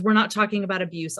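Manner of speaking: ordinary talk, nothing marked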